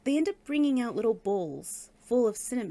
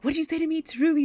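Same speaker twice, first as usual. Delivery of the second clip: high, loud indignant challenge; confrontational; 7 semitones higher, 6 dB louder, faster than usual. Retold or imitated. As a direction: demand it like an offended rival. Nadia confronts Ruby in acted quarrel.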